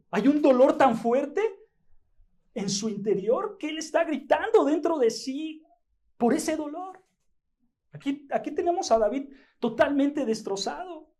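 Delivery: high, loud, fervent preaching; preaching at full force. Acted. Pastor telling brothers David was sick, full-force preaching. Preacher describes inner screaming.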